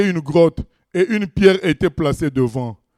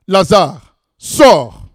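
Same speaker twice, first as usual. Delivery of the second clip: loud commanding call of Lazare, sors; loud cry; 4 semitones higher, 8 dB louder, much slower than usual. A narrator's reading. Jesus commanding Lazarus at the tomb, loud call. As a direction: call the dead man out with authority. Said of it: "il cria d'une voix forte".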